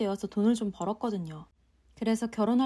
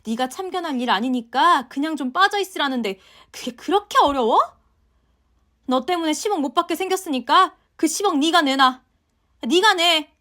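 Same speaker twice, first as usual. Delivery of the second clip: shrill, loud scolding mother-in-law; angry mother-in-law; 6 semitones higher, 8 dB louder than usual. Acted. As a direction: berate her daughter-in-law at full volume. Mother-in-law demands money. (화난 시어머니의 고함)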